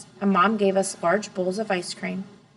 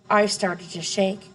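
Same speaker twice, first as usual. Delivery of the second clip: ordinary delivery, nothing marked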